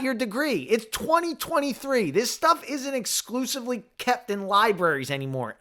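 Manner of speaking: high, loud indignant rant; pretend angry rant. The comeback he never said to the specialist, a pretend angry rant. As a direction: rant back at her, fired up. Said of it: "I did not say that".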